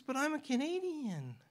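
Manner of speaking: small, high, timid voice; meek voice. Retold or imitated. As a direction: say it meekly, almost apologizing. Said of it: "She got a little meek voice like this".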